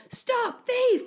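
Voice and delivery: high, loud cry of alarm; yell. A narrator's reading. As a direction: shout it out to stop a thief. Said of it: "wait for someone to yell".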